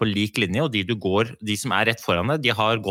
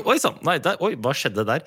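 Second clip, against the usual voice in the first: mock surprise; 5 semitones higher than usual. Acting out a staged accident.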